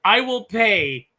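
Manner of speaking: each word dragged out and leaned on; I will pay said slow and heavy; slow emphatic. Real, not acted. Podcaster insists he would pay, slow emphatic.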